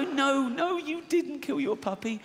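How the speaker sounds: high, mock-comforting; mock soothing. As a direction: coo it like a fake-kind uncle. Uncle consoling in high voice.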